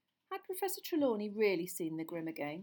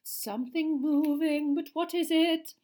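higher, slow, wondering seer voice; mysterious seer; 8 semitones higher, 10 dB louder, slower than usual. A narrator's reading. Seer gazing into the crystal ball, slow and wondering. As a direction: wonder aloud at the vision. Trelawney voice.

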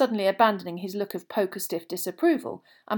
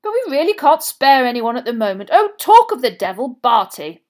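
loud, high, hearty voice; jovial official; 5 semitones higher, 12 dB louder than usual. A narrator's reading. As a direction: boom out a hearty greeting. Bagman's jovial voice, greeting Crouch.

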